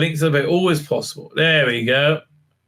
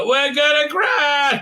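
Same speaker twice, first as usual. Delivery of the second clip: high, slow yell of alarm; panicked shout; 11 semitones higher, slower than usual. Acted. Cadet yells to crew as shuttle crashes, panicked shout. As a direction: cry out as the shuttle goes down.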